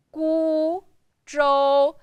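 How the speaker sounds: GU and ZHOU each drawn out on a high, level pitch; first tone, held. Shown as a demonstration. Teacher showing learners how to last the voice, long level tones. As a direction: hold gu, then zhou, long and flat. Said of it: "you need to last your voice a little bit longer".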